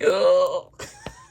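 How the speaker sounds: loud sharp gasp of delight; excited gasp. Real, not acted. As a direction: gasp in surprise. Excited unboxing reveal.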